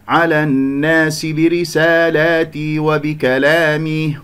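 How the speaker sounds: chanted with the long a of الناس and برسالاتي thinned toward "e"; tilted alif. Shown as a demonstration. Teacher imitating a common error, chant with tilted alifs. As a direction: spread the lips on every alif, making it "e". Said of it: "يقلل صوت الألف، يميل صوت الألف".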